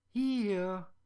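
put-on little girl calling. Little girl calling to the Sandman from her window, high and loud. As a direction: pipe up like a cheeky little girl.

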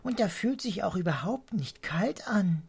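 high whisper of delight; whispered marvel. A narrator's reading. Mrs Swallow feels the blossom snow, high delighted whisper. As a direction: breathe it out in secret wonder.

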